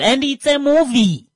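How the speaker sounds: loud, high-pitched exclamation; emphatic shout. Real, not acted. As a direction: shout it out, voice jumping way up. Exasperated shouted reminder to drivers.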